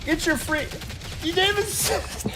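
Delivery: squeaky high mocking voice; shrill pitch-corrected teasing; autotune voice.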